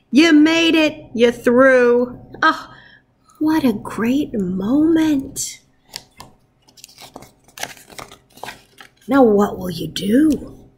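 lively picture-book read-aloud; reading to children. Acted. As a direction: read it like storytime for your class. Teacher reads picture book aloud.

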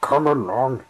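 puffing as he hurries. Acted. Engine puffing to his coaches as he sets off. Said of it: "he puffed".

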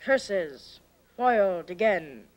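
low, slow, defeated villain snarl; mustache twirling villain. Acted. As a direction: spit it out like a foiled villain.

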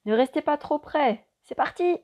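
high, loud excited shout. A narrator's reading. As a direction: shout the warning and go. Pilot shouts warning.

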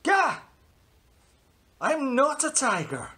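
loud high gasp of fright; sudden fearful cry; startled shout. Acted. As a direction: jump back in alarm. Shock at the crocodile.

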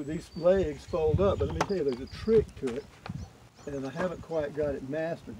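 normal talking voice

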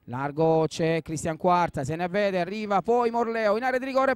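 ordinary talk, nothing marked

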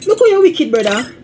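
high-pitched teasing cry of disbelief; mock-shocked exclamation. Real, not acted. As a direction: squeal it high and loud, playfully scandalised.

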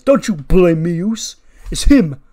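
loud, high, defensive outburst; Jey Uso. Acted. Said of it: "and Jimmy Jey goes".

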